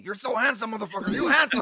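loud, high mock compliment; imitating angry potato. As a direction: bellow the compliment as a grumpy friend. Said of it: "angry potato consistently saying".

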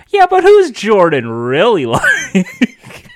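hammed-up tell-all teaser breaking into a laugh; mock exposé host. Jordan imagines the exposé's teaser, mock dramatic. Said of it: "sounds like an expose video".

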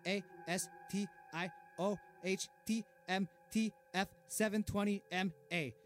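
fast, rattled-off letters; rapid rattled acronym. Acted. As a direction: rattle off the letters in one breath. Reels off a long acronym.

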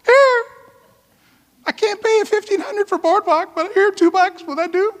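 high, slow, whiny plea of a man with two dollars left; high pleading broke dad. Retold or imitated. Dad losing at Monopoly begs his kids in a high whiny voice. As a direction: beg in a high put-on voice, offering two bucks. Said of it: "They're like".

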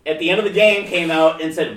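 usual speaking voice

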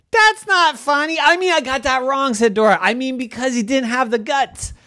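high, eager little pig calling her friends; Dora the pig voice. Acted. Pig Dora calling to her bored farm friends, high and eager. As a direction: squeal it out to get everyone's attention.

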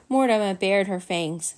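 usual speaking voice

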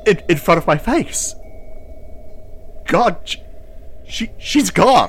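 high, loud, breaking anguish; anguished cry. Acted. Seer crying out that the goddess is gone. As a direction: cry out the loss as it sinks in.

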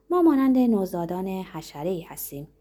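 usual speaking voice

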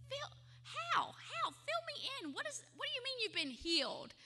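high, soft, disbelieving questions; can't believe what he's hearing; a quick startled "how?"; incredulous surprise. Retold or imitated. Incredulous friend hears claim.

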